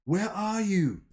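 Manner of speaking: high, searching call without much volume; mild yell. A narrator's reading. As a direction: call out high for someone out of sight. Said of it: "someone yells".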